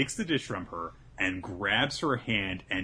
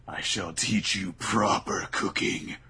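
menacing villain voice; 4 dB louder, slower than usual. Retold or imitated. Voicing villain Azami.

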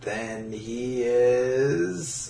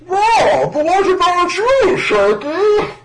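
very high, loud squeaky voice; high goon voice; 8 dB louder, much slower than usual. Acted. Goon voice in dub.

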